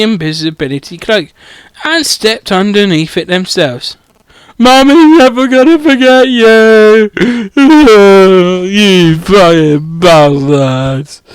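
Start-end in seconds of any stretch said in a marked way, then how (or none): 4.6-7.1 louder, mock-tender mummy voice; doting mummy. The reader coos goodbye to the baby dragon as its mummy. 7.1-8.7 a loud burst of laughter. Real, not acted. The reader cracks up at their own mummy voice, laughing aloud. 8.7-11.2 low, slow mock curse. The reader curses the dragon's takers, low and slow.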